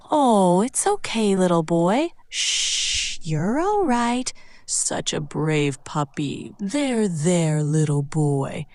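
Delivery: slow, soft reassurance for a puppy; gentle soothing voice. Shown as a demonstration. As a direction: hush and comfort a little one. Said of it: "That should help soothe him".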